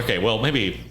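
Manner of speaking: normal talking voice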